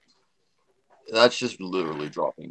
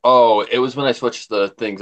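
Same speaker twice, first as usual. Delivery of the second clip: ordinary talk, nothing marked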